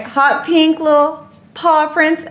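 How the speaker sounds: ordinary talk, nothing marked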